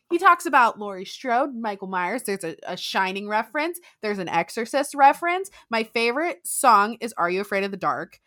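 ordinary talk, nothing marked

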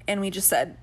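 ordinary talk

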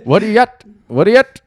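put-on Newfie accent. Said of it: "I, I can't really do".

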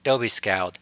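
plain reading voice

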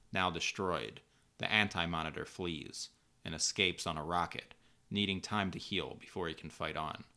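ordinary delivery

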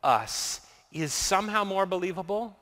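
quiet, low prayer voice; soft, reverent prayer to close. Acted. Closing prayer.

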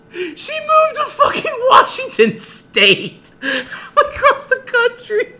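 high, slow, breaking up with laughter; laughing while talking. Real, not acted. Telling the podcast host she moved away, laughing hard. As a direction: push the words out through helpless laughter. Laughing at his own story.

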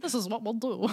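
silly put-on English royal accent; Queen of England. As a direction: play along as a mock English monarch. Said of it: "The queen was like".

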